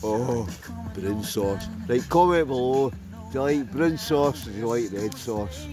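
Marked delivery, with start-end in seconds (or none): none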